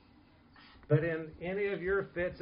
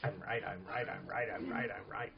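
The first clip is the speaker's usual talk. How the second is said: lower, softer singsong I'm right; smug quiet insisting; 6 semitones lower, 6 dB quieter than usual. Shown as a demonstration. Winning an argument without yelling, a soft smug repeat. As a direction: murmur it over and over, smugly. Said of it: "I don't have to yell about it, but I just go".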